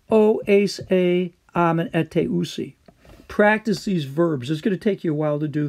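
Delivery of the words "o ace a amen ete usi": teacher reciting "O, ace, a, amen, ete, usi"; reciting endings. Shown as a demonstration. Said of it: "Learn your endings".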